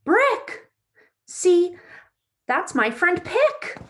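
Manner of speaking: bright, lively storybook read-aloud; reading to children. A narrator's reading. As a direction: read it like story time for little kids. Reads picture book to children.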